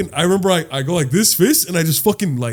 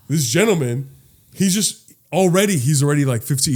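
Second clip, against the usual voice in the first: normal talking voice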